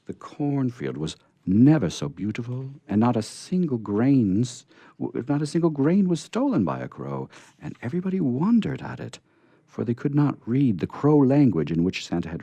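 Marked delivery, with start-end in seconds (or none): none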